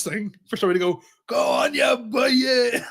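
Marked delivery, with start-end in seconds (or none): 1.3-2.8 high, rousing Irish cheer; drawn-up shout of encouragement; mock cheering. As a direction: call it out like a supporter egging him on.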